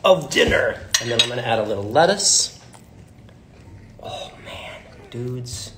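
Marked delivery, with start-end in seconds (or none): 4.0-5.7 whispered. Real, not acted. Tasting the taco.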